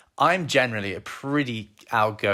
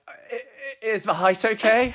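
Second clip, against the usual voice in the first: high, stammering nervous voice; 10 semitones higher, slower than usual. Retold or imitated. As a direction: stumble out the words like a tongue-tied suitor. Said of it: "that's all I could muster".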